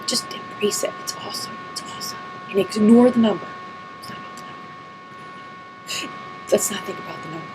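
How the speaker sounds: tearful, whispered near silent; real tears choking the words to a whisper; softly breaking, barely voiced; emotional whisper. Real, not acted. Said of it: "that got me really emotional".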